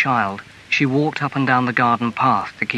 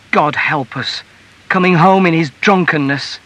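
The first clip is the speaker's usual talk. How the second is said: exasperated wife crying out, rising louder and higher; dismayed outcry; 5 semitones higher, 5 dB louder than usual. A narrator's reading. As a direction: cry out in dismay at a drunken husband. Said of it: "cried Missus Morrell".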